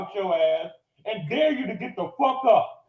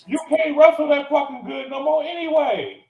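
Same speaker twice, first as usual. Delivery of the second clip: ordinary delivery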